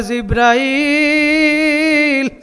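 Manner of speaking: high, very drawn-out summons; calling out. Acted. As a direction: call the angel with a long chanted vowel. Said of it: "আমার মাওলা ডাক দিয়া বলে". (উঁচু সুরে টেনে ডাক)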